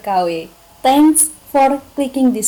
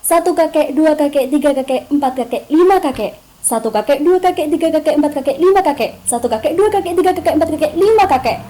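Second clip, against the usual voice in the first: higher-pitched rapid count, each round run together; quick tongue-twister drill; 5 semitones higher, 4 dB louder, faster than usual. Shown as a demonstration. Coach demonstrating a jaw warm-up, fast tongue-twister drill. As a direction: rattle it off as a rhythmic warm-up. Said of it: "Contohnya kayak gini".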